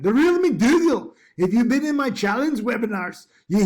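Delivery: a big, mock Scottish burr on the name; Scottish accent. Bored trader greeting a student in a mock Scottish accent. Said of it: "try to do the Scottish accent".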